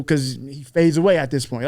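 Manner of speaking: ordinary talk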